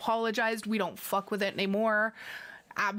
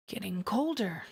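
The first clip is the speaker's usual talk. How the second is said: ordinary talk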